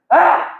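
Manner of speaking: sharp high yelp; imitated scream. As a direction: mimic a startled shriek from inside. Scream heard from inside.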